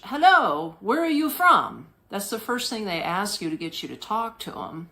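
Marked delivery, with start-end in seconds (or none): 0.0-1.9 imitating a vendor's broken English, higher and louder; broken-English street vendor voice. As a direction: call out in halting broken English. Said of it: "in her broken English".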